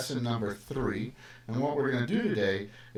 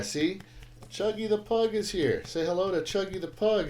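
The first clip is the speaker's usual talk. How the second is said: higher, cooing voice for the dog; pet talk; 8 semitones higher than usual. Real, not acted. Teacher cooing over his pug on the livestream, higher pet-talk. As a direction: coo like you're showing off a puppy.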